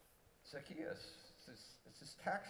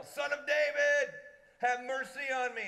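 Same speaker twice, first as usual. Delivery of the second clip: much higher, loud, drawn-out plea from afar; desperate yell; 12 semitones higher, 11 dB louder than usual. Retold or imitated. Blind man calling to Jesus from the distance, desperate yell. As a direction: cry out over the crowd, slowly. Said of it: "somebody yell".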